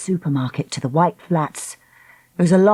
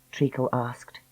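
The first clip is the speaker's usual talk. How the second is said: plain reading voice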